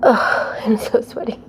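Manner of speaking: breathless, whispered, after a workout; out of breath. Real, not acted. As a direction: gasp it out, winded. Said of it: "Are you as out of breath as me?".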